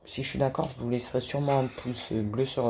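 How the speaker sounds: usual speaking voice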